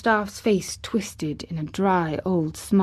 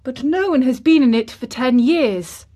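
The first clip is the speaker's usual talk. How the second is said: put-on high, loud, incredulous boy; cried out; 6 semitones higher, 6 dB louder than usual. A narrator's reading. Colin disbelieves the gardener got in, crying out. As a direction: protest in astonishment. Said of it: "cried Colin".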